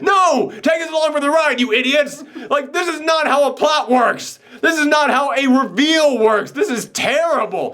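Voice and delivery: high, indignant outburst; exasperated rant. Acted. Movie fan protesting a badly written plot reveal, exasperated. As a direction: rant it high, stretching the key words. Acted rant at a botched twist.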